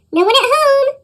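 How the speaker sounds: put-on boy shouting at the pounding trolls, high and loud; shouted. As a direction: shout it through the door. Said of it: "the boy from Finnmark shouted".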